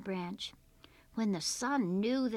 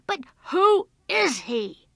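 loud, high, exasperated demand; boy losing patience, shouting; angry insistence; 8 semitones higher, 14 dB louder than usual. A narrator's reading. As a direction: stamp out the question. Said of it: "The boy stamped his foot".

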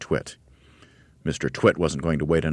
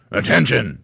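higher, louder drill bark; barked command; 7 semitones higher, 5 dB louder than usual. Acted. Mister Twit barking orders at the monkeys. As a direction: bark like a fearsome trainer. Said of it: "barked in his fearsome monkey trainer's voice".

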